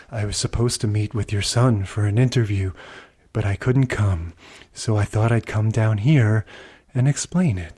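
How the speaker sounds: low, subdued mumble of a mild-mannered guest; underplay it, flat and low like a bored actor; low-key ballplayer. Retold or imitated. Ballplayer explaining the missed interview to the dad, low and subdued. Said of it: "He's just very low-key".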